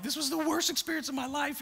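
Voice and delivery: high-pitched mock despair; distressed complaint. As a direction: act out an overwhelmed rafter. Retold despair during the raft ride.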